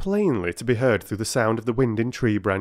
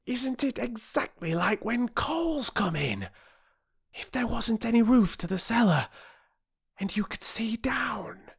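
high, hushed wonder fading to a whisper; awestruck boy; 13 semitones higher than usual. A narrator's reading. Boy comparing the fall to coal, high hushed awe. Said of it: "in awestruck tones".

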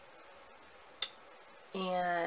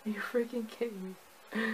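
exasperated; 4 semitones higher than usual. Retold or imitated. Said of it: "I'm just like".

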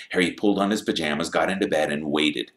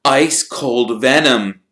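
usual speaking voice

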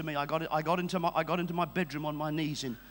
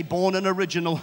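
rhythmic preaching building to a huge shout; full-force preaching; 3 semitones higher, 10 dB louder than usual. Real, not acted. Preacher rousing the congregation to stir up the anointing, building shout.

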